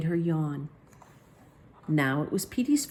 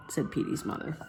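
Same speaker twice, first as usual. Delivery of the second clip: usual speaking voice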